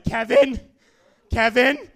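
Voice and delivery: loud cry for a friend; panicked calling. Acted. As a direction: call out urgently for help.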